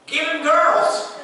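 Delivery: loud, high, slow deadpan verdict; God answering Jesus, loud and slow punchline; comic God punchline. Pretend. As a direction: drop the punchline big and slow. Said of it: "You know what God said?".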